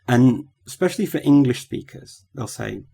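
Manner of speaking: ordinary delivery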